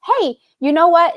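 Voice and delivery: usual speaking voice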